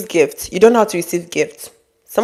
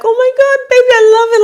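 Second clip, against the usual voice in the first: much higher and louder, squealing with delight; excited; 5 dB louder, much faster than usual. Retold or imitated. Wife thanking her husband for a birthday gift, thrilled. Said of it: "she's so excited".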